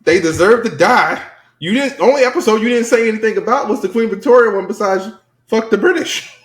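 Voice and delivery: high-pitched, incredulous rebuttal; worked-up comeback; indignant protest. Real, not acted.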